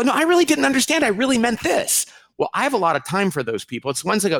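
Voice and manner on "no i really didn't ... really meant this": high-pitched imitated insistence; earnest protest. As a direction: play someone desperate to be believed. Voicing humble person's earnest protest.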